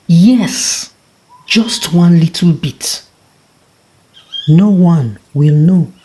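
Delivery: the tortoise gleefully deciding; a lightly voiced trickster sure of himself; eager tortoise. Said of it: "He chuckled to himself".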